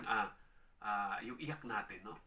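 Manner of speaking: usual speaking voice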